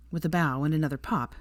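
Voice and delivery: ordinary delivery, nothing marked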